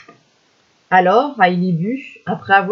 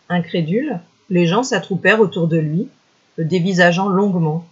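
plain reading voice

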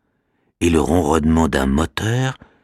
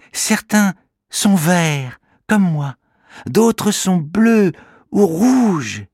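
proud little creature; 14 semitones higher, 4 dB louder than usual. Acted. Kimamila describing his many friends, proud little creature voice. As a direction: tell it proudly, taking your time. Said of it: "répond Kimamila".

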